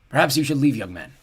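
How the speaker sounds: ordinary delivery, nothing marked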